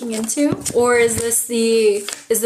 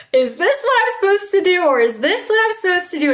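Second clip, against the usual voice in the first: higher-pitched mock dithering; acted confusion; 7 semitones higher than usual. Astrologer voicing a client's confusion, mildly acted uncertainty. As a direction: voice someone torn between options, pitch raised. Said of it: "feel, like, a sense of confusion".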